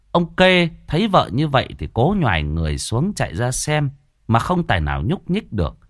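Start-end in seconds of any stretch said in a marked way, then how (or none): none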